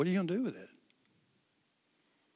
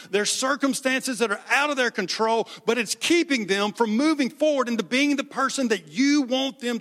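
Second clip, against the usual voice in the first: higher, louder pleading prayer; fervent prayer; 5 semitones higher, 7 dB louder than usual. Acted. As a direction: pray passionately over the congregation. Closing prayer to God.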